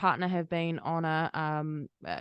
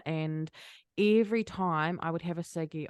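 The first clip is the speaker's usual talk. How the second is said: ordinary talk, nothing marked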